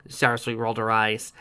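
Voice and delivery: ordinary delivery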